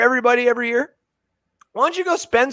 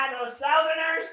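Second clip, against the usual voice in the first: fake-heckle the Southerners; mock shout; 8 semitones higher, 6 dB quieter, slower than usual. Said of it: "No, I'm just kidding".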